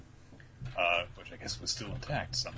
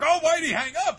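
high, loud exasperated cry; retold outraged complaint; mock indignant yell; 9 dB louder, faster than usual. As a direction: shout it like someone hung up on, hammed-up. Hack cut off mid-call.

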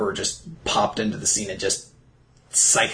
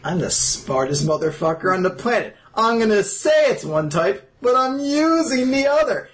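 louder and higher, building smug triumph; smug mock boast; 9 semitones higher, 3 dB louder than usual. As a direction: gloat like a genius villain. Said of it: "I would have been like".

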